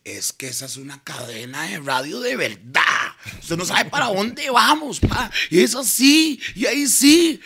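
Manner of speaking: retold excitement climbing high in pitch; wildly excited. Chino raves about the new radio chain, wildly excited. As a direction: build to a high, crazed enthusiasm. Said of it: "Chino se volvió loco".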